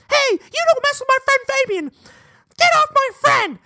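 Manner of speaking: very high, squeaky mock shout; scrawny kid voice. Scrawny classmate defending his friend Fabian, squeaky mock yell. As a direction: pitch it way up, small kid standing up for a friend. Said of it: "the scrawniest guy in my fifth grade class".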